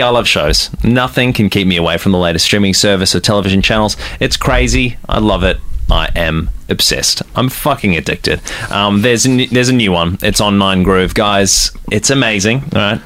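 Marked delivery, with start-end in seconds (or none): none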